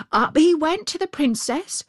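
usual speaking voice

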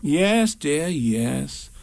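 calm him down gently; soothingly. Acted. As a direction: Kanga soothing the indignant Piglet. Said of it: "said Kanga soothingly".

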